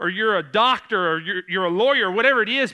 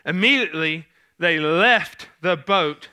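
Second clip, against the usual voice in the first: ordinary talk, nothing marked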